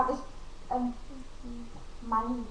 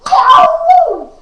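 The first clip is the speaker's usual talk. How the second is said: excited cheer; 13 semitones higher, 18 dB louder than usual. Acted. Cheering at going through.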